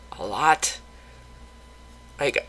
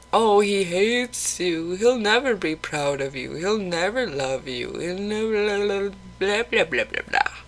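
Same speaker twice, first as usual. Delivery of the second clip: inner sad voice; 5 semitones higher than usual. Retold or imitated. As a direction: voice the cruel sad thoughts, then trail into blah. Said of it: "that sad voice".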